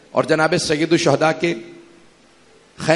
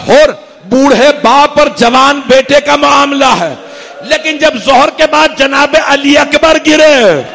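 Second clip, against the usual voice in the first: higher and louder, building to a wailing shout; full-force lament preaching; 8 semitones higher, 9 dB louder than usual. Acted. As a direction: pour out the grief, crying out at the end. Final masaib, Ali Akbar lament. (پوری شدت سے گریہ آمیز مصائب، آخر میں چیخ)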